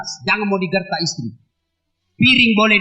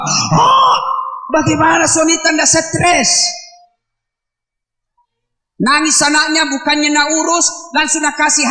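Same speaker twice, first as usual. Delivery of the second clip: mock outcry; 5 semitones higher, 4 dB louder than usual. Mock outcry about stressed husband.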